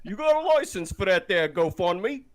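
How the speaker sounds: high, loud put-on British accent; mock British accent. Teasing about the GoFundMe, mock British accent. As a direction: ham up a British accent for a joke. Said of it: "you don't like my British accent".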